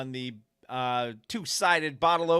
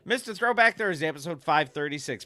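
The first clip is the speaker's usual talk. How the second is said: normal talking voice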